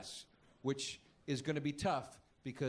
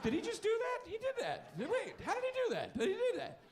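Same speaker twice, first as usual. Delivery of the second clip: much higher, incredulous and laughing; stunned disbelief; 14 semitones higher than usual. Retold or imitated. Comic describing an SUV driver, stunned disbelief. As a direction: gape at a magic trick you can't believe. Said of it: "like you just saw a magic trick".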